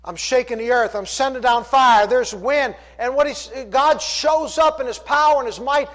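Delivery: high, emphatic pulpit delivery; impassioned preaching. Acted. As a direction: build the scripture drama with force. Preaching at full force.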